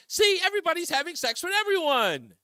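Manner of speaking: high mocking voice. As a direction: sneer it up high. Mocking the sexual revolution.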